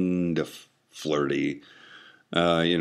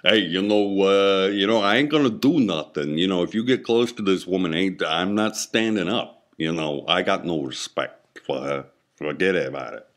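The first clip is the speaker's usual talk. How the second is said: put-on Bronx accent. Penis refusing to stand up, in a Bronx accent. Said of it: "apparently my penis is from the Bronx".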